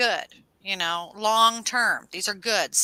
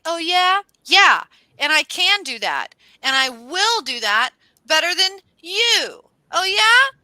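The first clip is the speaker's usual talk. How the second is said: loud, high cocky boasting; fired up; 10 semitones higher, 11 dB louder, slower than usual. Acted. Boasting to a rival they can do better, fired up. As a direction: throw down the challenge at full volume. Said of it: "we were really fired up".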